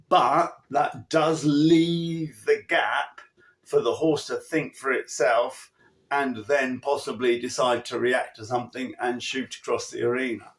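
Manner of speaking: usual speaking voice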